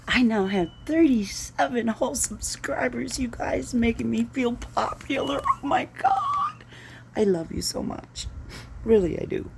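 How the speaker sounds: high, breathy giddy whisper; hammed-up thrilled whisper to viewers; mock giddy whisper. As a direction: gush like a starstruck fan, whispering. Gushing over thirty-seven subscribers.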